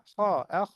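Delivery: "খ, আহ" with a heavy, rasped Arabic kh; thick, full kh in "খ, আহ"; heavy kha. Shown as a demonstration. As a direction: raise the back of the tongue on "খ, আহ". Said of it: "আওয়াজটা ভারী হবে".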